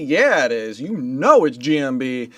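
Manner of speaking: usual speaking voice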